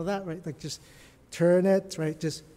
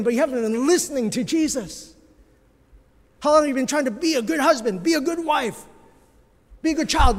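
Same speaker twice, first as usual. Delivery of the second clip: higher, louder rising challenge to the flock; preaching at full force; 8 semitones higher, 6 dB louder, faster than usual. Acted. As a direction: press each question harder.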